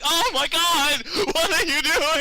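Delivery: high, loud disbelief; exasperated cry. Retold or imitated. Fed up with the dawdling clerk, high loud exasperation. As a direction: yell in frustration. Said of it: "I'm like".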